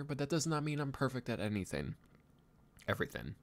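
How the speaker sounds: ordinary talk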